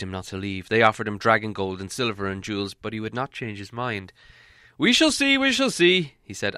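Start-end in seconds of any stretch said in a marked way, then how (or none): 4.8-6.1 put-on a raised, knowing old wizard's voice. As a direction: pitch it up, wise and unbothered. Wizard's reply in story.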